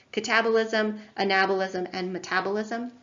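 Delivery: "catabolism, anabolism, and metabolism" stressed on TAB, NAB, TAB; second-syllable stress. Shown as a demonstration. Lecturer teaching students, catabolism, anabolism and metabolism each stressed on the second syllable. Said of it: "the emphasis on the second syllable".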